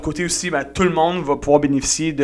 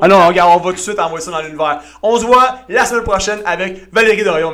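louder and higher, like an emcee; excited announcement; 5 semitones higher, 8 dB louder than usual. Real, not acted. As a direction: proclaim it to the universe. Annonce lancée à l'univers. (annonce enthousiaste)

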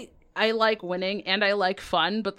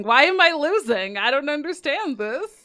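louder, higher, baffled; baffled whine; 6 semitones higher, 5 dB louder than usual. Retold or imitated. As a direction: whine in mock confusion. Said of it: "and going".

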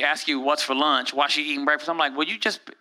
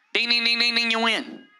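higher-pitched ringing "ding" run; rapid monotone dinging like a prize machine; imitated winner bell; 4 semitones higher than usual. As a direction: mimic a game bell, then announce the win.

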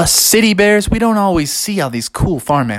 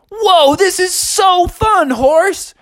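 high excited voice; much slower than usual. Acted. Bear enjoying ride.

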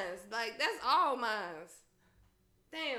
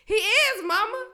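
loud retort; 7 semitones higher, 12 dB louder than usual. Retold or imitated. Retorting to mom's remark.

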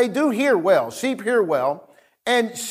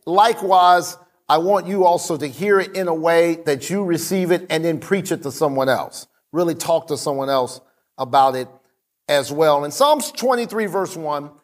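ordinary talk

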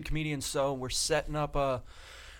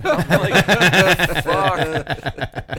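loud, drawn-out words through laughter; laughing incredulously; 3 semitones higher, 13 dB louder, much slower than usual. Real, not acted. Comic reacting to a bad introduction, laughing loudly. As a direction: crack up on the retold reaction.

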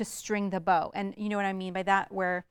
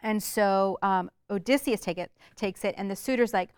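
normal talking voice

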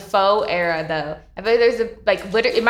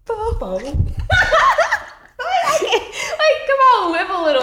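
laughing hard; 4 dB louder, slower than usual. Real, not acted. Laughing while quoting.

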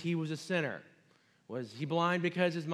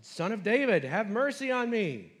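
louder, higher read-aloud cry; shouting louder; 6 semitones higher, 6 dB louder than usual. Acted. Beggar shouting louder over rebuking crowd, raised pleading cry. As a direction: plead a bit harder, raised voice. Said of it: "he shouted all the more".

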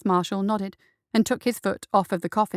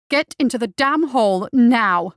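loud, stress on damn; the judge mocking her order, loud and fed up; exasperated order; 3 semitones higher, 7 dB louder than usual. Judge sarcastically snaps at Justice.

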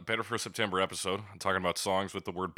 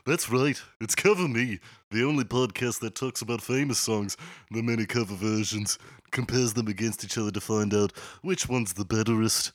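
put-on broad Liverpool accent, podcast intro; 2 semitones higher, 5 dB louder than usual. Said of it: "best I can do for a Liverpool accent".

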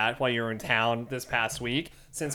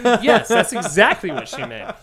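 sarcastic agreement; 6 semitones higher, 3 dB louder than usual. Pretend. Mock agreement to manipulate her.

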